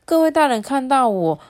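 usual speaking voice

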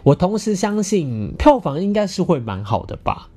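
usual speaking voice

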